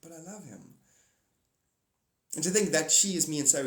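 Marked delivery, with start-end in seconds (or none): 0.0-0.8 imitating friend Candy. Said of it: "she's like".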